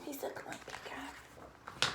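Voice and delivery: slow whisper. Real, not acted. Slow whisper repeating the line.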